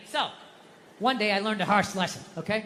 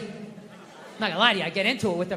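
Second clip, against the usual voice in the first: ordinary talk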